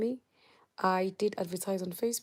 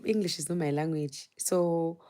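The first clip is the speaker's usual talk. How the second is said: usual speaking voice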